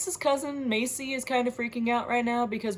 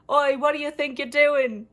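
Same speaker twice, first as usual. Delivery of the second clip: raised, louder British "Oi" voice; British accent; 4 semitones higher, 4 dB louder than usual. Acted. Jackson comes by to confront Flint, British accent. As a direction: put on an indignant British accent. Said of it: "was that a British accent?".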